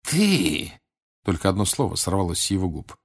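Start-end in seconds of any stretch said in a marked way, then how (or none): 0.0-0.7 high, stretched gasp of recognition; startled, hostile exclamation; fury mixed with fear. A narrator's reading. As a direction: spit it out in fearful rage. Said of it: "проступила ярость, смешанная со страхом".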